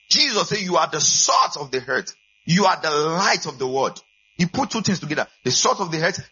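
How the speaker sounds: fired-up sermon voice; full-force preaching. Acted. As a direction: preach it out, rising to the back row.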